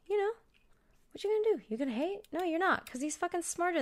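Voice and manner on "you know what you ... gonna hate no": taunting haters. Real, not acted.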